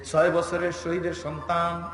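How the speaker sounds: usual speaking voice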